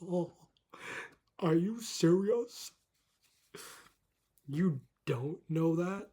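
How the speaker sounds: slow, drawn-out are you serious; hint of a snooty staffer; condescending disbelief. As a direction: talk down to the customer. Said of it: "They're like".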